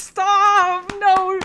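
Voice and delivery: loud laughing shout; loud protest. Real, not acted. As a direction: yell it through a laugh.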